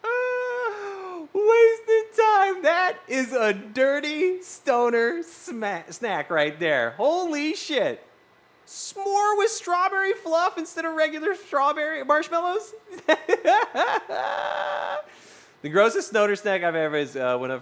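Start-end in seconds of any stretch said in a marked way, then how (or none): 0.0-2.6 high, loud crying wail; hammed-up howl of despair; anguished mock wail. Anguished mock cry over snack. 2.6-12.7 high, slow, incredulous drawl; hammed-up outrage at the snack; mock disbelief. Exaggerated disbelief after wail. 12.8-15.0 high burst of laughter; real high laugh. Real, not acted. Laughs after bit.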